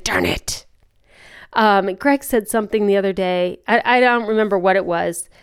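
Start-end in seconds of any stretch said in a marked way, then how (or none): 0.0-0.6 breathy whispered darn it, under the breath; muttered darn it. Retold or imitated. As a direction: let it slip out as a defeated whisper. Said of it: "I was like".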